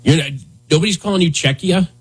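ordinary talk

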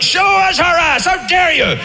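shouted high, angry demand; furious Greeks shouting. Acted. Furious Greek soldiers demanding Helen, shouting up at the walls. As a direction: bellow like an army at the gates. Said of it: "They're like".

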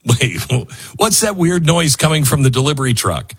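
ordinary talk

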